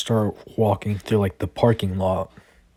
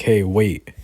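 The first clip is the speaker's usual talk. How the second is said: louder call to stop someone leaving; 6 dB louder than usual. Retold or imitated. Guard calling after Deku walking away, loud. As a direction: shout after him to stop.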